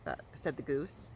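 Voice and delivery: usual speaking voice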